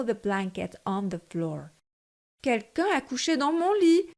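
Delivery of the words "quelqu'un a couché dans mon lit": higher, moderate mama bear; a motherly bear, a touch raised; Mama Bear's voice. A narrator's reading. Narrator voicing Mama Bear seeing her pillows, higher. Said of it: "de sa moyenne voix".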